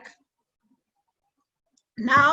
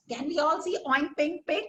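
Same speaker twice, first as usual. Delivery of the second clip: ordinary delivery, nothing marked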